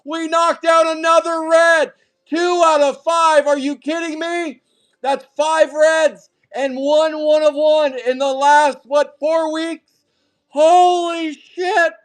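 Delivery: loud, high, stretched-out shouting over the pulls; high, loud, hyped card-break shouting; hyped yelling. Real, not acted. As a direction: yell it like you can't believe the luck. Card break hype over red hits.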